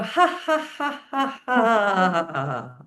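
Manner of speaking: laughter yoga ha ha; forced laughter. Shown as a demonstration. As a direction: laugh out the breath. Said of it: "breathe in and go".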